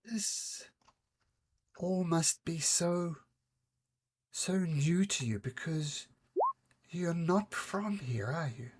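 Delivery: lightly voiced kindly seer woman; gentle seer. GM voicing the seer Versi.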